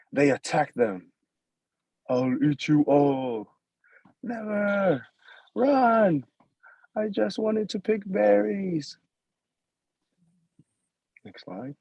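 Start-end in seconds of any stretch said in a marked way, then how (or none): 2.1-3.6 slow, menacing monster growl. Acted. Caribou threatens the women. 4.2-9.0 high, panicked cry; frightened. Acted. Women flee the caribou.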